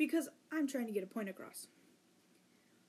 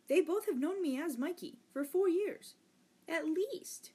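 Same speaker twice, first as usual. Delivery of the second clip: louder and higher, fed up and incredulous; exasperated outburst; 7 semitones higher, 6 dB louder than usual. Real, not acted. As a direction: let the frustration burst out. Venting about friends ignoring his name.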